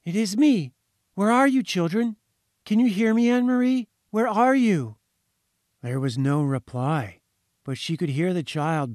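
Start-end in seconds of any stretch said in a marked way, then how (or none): 0.0-5.0 high and loud calling into the phone; reaching across a great distance; anxious calling. A narrator's reading. Mother calling her lost daughter down the line, loud. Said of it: "she shouted triumphantly".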